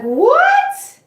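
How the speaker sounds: loud, high-pitched yelp of disbelief; shocked. Retold or imitated. Patient told they gave fentanyl, re-enacted shocked outburst. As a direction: shoot way up in pitch, aghast.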